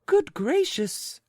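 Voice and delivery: very high, slow astonished cry; startled exclamation. Acted. Lady startled by the stranger, very high. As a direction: gasp it out astonished. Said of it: "exclaimed the lady".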